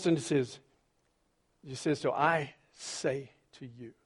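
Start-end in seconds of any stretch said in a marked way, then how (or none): 2.2-3.9 slow, drawn-out decree; a slow hint of Jesus commanding; commanding declaration. Shown as a demonstration. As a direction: spell it out slowly with authority. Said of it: "How's that?".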